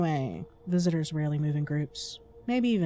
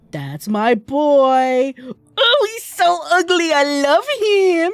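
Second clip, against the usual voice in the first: cooing over the ugly cat; doting squeal; 8 semitones higher, 10 dB louder, slower than usual. Real, not acted. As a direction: gush over a pet.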